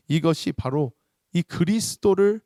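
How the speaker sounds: ordinary talk, nothing marked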